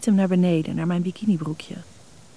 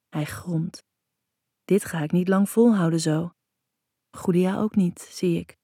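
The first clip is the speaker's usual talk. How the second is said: ordinary delivery, nothing marked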